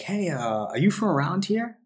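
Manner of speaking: a hint of a girl asking; girl's voice. Girl's voice in story.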